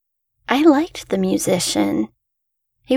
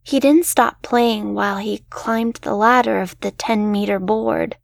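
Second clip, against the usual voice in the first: plain reading voice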